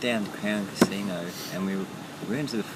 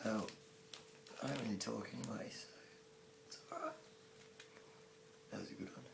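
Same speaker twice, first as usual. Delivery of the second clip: faint, nearly inaudible reply; very soft, voice giving out; lost voice; 17 dB quieter, faster than usual. Real, not acted. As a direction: answer with almost no voice left. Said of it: "turned into a non-voice".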